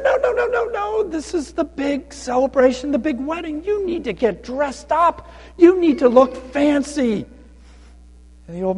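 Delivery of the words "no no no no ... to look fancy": much higher-pitched protest; fussy protesting guards. Acted. Guards turn away the underdressed old man, high protesting voice. Guards refuse him entry.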